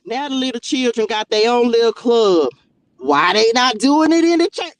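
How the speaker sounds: loud, rising challenge; fired-up preacher shout; loud preaching. Real, not acted. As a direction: shout the point home. Preaching rant climax.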